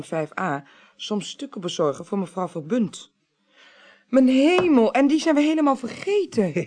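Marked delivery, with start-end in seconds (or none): 4.1-6.5 sudden dismay. Acted. Suddenly remembers forgotten delivery.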